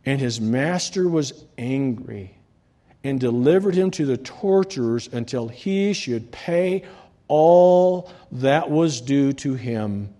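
lifted pulpit reading voice; scripture reading. Acted. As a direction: proclaim the parable to the church. Reading scripture aloud to congregation.

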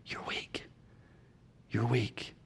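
hushed you're weak; whisper. Shown as a demonstration. Pastor reminding hearers they are weak, whispered. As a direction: breathe it out in a whisper.

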